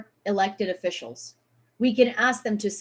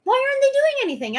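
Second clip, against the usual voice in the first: high, fast, louder repeat of the yell; real fury, much higher and rushed; outraged yell again; 3 dB louder, much faster than usual. Real, not acted. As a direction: shout it once more, fast and high.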